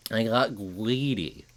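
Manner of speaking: slow nerdy voice, put-on; a lightly voiced nerd. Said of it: "I love doing my nerd voice".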